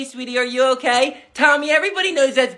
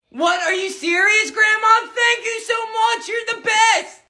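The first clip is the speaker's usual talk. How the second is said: put-on high, eager boy voice; excited kid; 8 semitones higher than usual. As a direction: squeal with excitement. Kid gets a new iPad.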